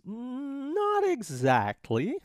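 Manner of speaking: high, very slow, stretched hedge; drawn-out hedging. Acted. Marshall answering the remedy question, hedging slowly.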